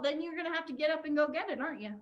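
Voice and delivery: normal talking voice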